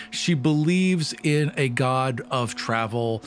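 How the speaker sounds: normal talking voice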